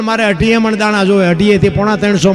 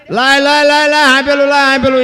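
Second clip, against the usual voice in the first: louder, higher hurried demand; urgent demand; 5 semitones higher, 4 dB louder than usual. Acted. Husband urgently orders wife to hand it over, loud. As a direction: give it, quick.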